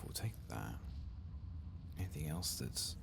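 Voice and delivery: ordinary delivery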